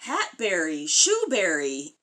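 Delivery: ordinary delivery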